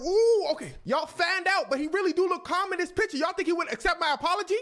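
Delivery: loud, high, rattled bluster; mock panic building into a pleading question; panicked backpedalling. [name] realising he must apologise, panicked. Boaster suddenly loses his nerve.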